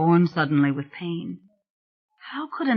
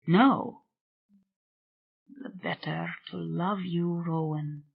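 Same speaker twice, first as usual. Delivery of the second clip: hushed and soft, close to her; soft whisper; 6 dB quieter than usual. A narrator's reading. Spirit to Rowan, a soft tender hush. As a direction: breathe it softly to her. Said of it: "he whispered".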